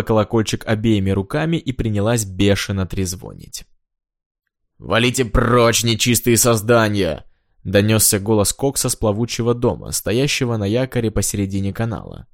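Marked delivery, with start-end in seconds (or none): 4.8-7.2 slow, drawn-out theatrical banishing; indignant shooing. Acted. Minstrel on a houseboat banishes night visitors, slow and theatrical. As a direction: send them away grandly, stretching the words.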